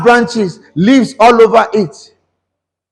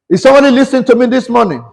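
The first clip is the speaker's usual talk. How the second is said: rapid preacher's call; 3 semitones higher, much faster than usual. Real, not acted.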